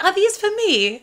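put-on high, delighted old lady; sweet old lady voice. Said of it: "she was like".

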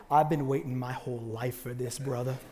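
low, quiet, cool-guy voice; pickup-truck driver. Retold or imitated. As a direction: imitate a man murmuring solemnly to a brother.

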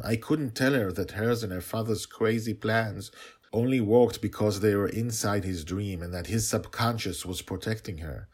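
usual speaking voice

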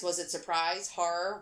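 plain reading voice

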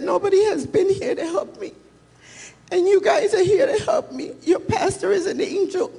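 high, half-laughing, half-crying retelling of the woman; retold tearful plea. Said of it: "and she was crying".

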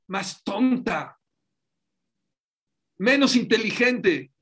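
más alto y fuerte, despacio; énfasis predicado. Acted. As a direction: martilla cada palabra del Midrash. Predicador recalca el Midrash. (el rabino recalca el Midrash, fuerte y lento)

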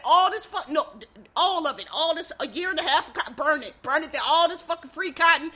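hammed-up indignant outburst; worked-up mock rage. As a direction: rant as if ready to torch it all. Acting out vengeful burning of cotton.

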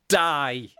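a touch of comic menace; Aggressive Piglet. Acted. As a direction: blurt it as an abrupt punchline. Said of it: "An aggressive Piglet replies".

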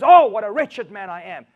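very loud acted lament; anguished Paul. Paul despairing of his own sin, loud anguished cry. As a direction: cry out in self-reproach.

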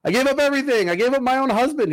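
anguished outcry. Retold or imitated. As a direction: cry it out in anguish. Retelling movie scene.

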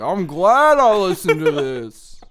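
loud, slow, hammed-up gushing; mock delighted listener. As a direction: overplay the happy fan.

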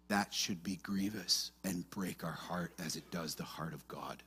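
hushed sincere lament; grieved and quiet. Real, not acted. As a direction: let the sorrow sink the voice. Grieving over idolatry.

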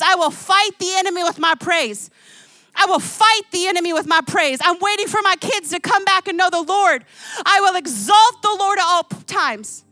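high, loud impassioned preaching; preaching at full force. Acted. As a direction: build the congregation up. Preaching climax.

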